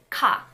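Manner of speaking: strong aspirated ka with a burst of air. Shown as a demonstration. Teacher demonstrating an aspirated ka sound. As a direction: push air out hard on ka. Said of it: "Ka is pretty much the same as the English K A".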